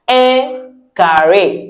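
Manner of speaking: "Un carré" slowly, with an English r; easy English R. Shown as a demonstration. Teacher helping learners, slow English-r "carré". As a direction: say "carré" like the start of carrying. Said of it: "as if you're saying carrying something".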